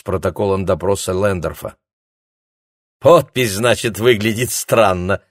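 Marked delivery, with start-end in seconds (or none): 3.0-5.2 smirking mockery. A narrator's reading. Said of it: "усмехнулась она".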